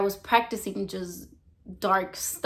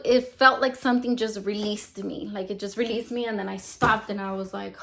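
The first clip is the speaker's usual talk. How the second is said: usual speaking voice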